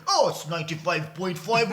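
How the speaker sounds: a lightly hammed station announcer; mock radio presenter voice. Host mocks how a friend changes on radio, lightly hammed announcer voice. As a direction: imitate a friend's put-on on-air voice. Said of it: "you start talking".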